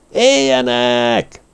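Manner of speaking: high, slow, drawn-out crowd cheer; a whole crowd hailing as one voice; chanting druids cheer; druids roaring. A narrator's reading. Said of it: "zúgták a druidák".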